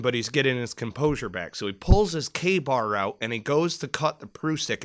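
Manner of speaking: ordinary delivery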